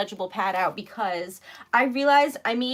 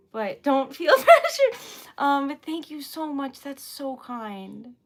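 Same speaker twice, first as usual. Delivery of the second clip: ordinary delivery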